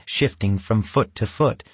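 ordinary delivery, nothing marked

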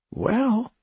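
high surprised drawn-out "Well". A narrator's reading. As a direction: sound caught off guard. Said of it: "Tim said, surprised".

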